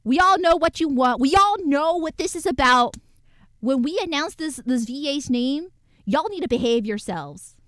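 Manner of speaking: stern announcement. Retold or imitated. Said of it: "publishing account was like".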